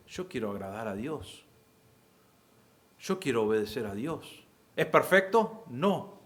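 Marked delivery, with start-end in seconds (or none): 0.1-4.4 a hushed, much softer vow; quietly mean every word of the promise; sincere believer. Retold or imitated. Preacher voicing a believer's hushed promise to obey God, sincere and soft. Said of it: "el creyente dice".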